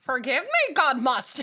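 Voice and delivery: loud, high mock confession; hammed-up pious plea; mock solemn. Joking mock prayer line.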